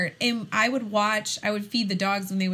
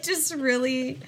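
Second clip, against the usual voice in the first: ordinary delivery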